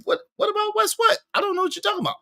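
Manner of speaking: very high falsetto protest; mock bewilderment. Streamer puzzled by a chat comment, mock-bewildered falsetto. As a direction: squeak it out in baffled falsetto.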